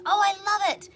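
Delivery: high and loud, thrilled; son-in-law gushing over his gift, high and loud; delighted son-in-law. Retold or imitated. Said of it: "He goes".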